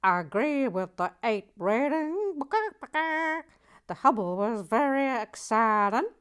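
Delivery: loud, high Boston Nancy voice, badly done; bad Boston accent. To viewers, reviewing the set as Boston Nancy in a loud bad Boston accent. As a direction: belt it out in a terrible Boston accent. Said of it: "I can't do a Boston accent".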